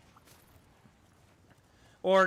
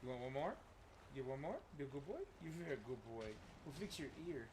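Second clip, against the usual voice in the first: doting dog talk; 5 semitones lower, 19 dB quieter than usual. Real, not acted. Owner offering his good boy treats, doting pet talk. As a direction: coo softly with questions lilting up at the end. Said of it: "I used to talk to them like that".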